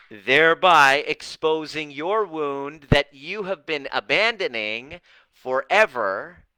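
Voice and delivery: ordinary talk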